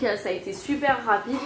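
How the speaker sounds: ordinary delivery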